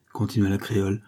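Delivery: ordinary delivery, nothing marked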